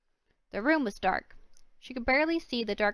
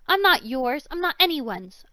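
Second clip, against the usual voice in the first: loud defiant cry; 5 semitones higher, 8 dB louder than usual. Acted. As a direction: throw it back at him, loud. Girl defies her captor.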